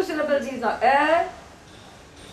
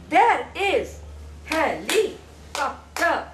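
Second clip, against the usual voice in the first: "There is helicopter" slowly, each syllable set apart; much slower than usual. Shown as a demonstration. Teacher shows child how to say it, syllable by syllable. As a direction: model "There is helicopter" in slow, separated beats. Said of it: "like I'm saying it".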